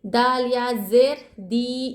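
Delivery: very slow, खा and दी each held one alif length; teacher chanting the letters of खालिदी; stretched tajweed spelling. Shown as a demonstration. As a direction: draw out खालिदी syllable by syllable. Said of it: "या मद्दा को एक अलिफ की मकदार खींचेंगे".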